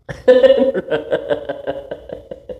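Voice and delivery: long bright burst of real laughter. Real, not acted. Laughs at her own talk.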